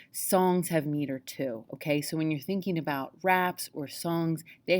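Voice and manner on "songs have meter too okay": usual speaking voice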